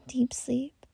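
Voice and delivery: plain reading voice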